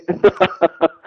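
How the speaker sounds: a brief amused laugh; short laugh. Real, not acted. Laugh mid argument.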